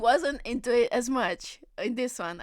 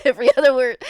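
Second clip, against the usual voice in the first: laughing; 2 semitones higher, 12 dB louder, much faster than usual. Real, not acted. Streamer laughing loudly.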